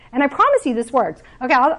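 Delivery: ordinary delivery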